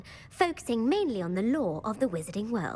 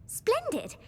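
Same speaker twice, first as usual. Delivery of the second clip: a much higher, thrilled exclamation; delighted; 13 semitones higher than usual. Acted. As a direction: leap up with glee. Quizmaster delighted player accepts quiz.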